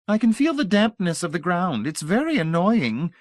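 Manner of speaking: put-on high, honeyed old lady voice; sugary old lady. A narrator's reading. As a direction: pour on the sweetness like a grande dame. Voices Mrs Pritchett.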